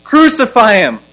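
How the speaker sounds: loud, high mob cry; crowd crying out. Acted. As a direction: cry it out like a crowd demanding death. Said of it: "they cried out".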